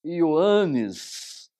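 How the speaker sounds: Ioannes said slowly and loud, stressed on the a; Greek pronunciation. Shown as a demonstration. Teacher modelling Ioannes in Greek for students. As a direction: spell out Ioannes for the class. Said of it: "Temos aqui alguns exemplos".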